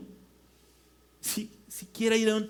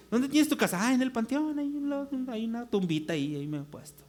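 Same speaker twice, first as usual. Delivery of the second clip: mock casual chat; 5 semitones higher than usual. Preacher acts out someone living in a cemetery, mock casual. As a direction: play it as an absurd everyday chat.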